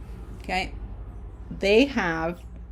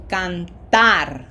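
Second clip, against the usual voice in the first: Cantar with a louder, held TAR; stress on last syllable; 5 dB louder than usual. Shown as a demonstration. Teacher modelling Spanish word stress, Cantar stressed on TAR. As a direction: punch the final syllable of Cantar. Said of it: "red syllable is what has pronunciation".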